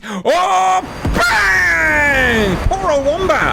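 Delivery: very high, drawn-out exclamation; excited outcry. Real, not acted. Commentator reacting to Wombat being blown up, excited outcry. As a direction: cry out at a sudden hit. Reacts to a sudden missile hit.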